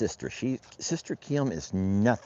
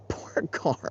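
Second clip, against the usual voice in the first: soft, high words squeezed out through giggles; laughing; 6 semitones higher, 7 dB quieter than usual. Real, not acted. Rancher pitying poor Carl, quiet breathless laughing. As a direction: pity him while helplessly laughing. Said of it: "I'm sorry for laughing".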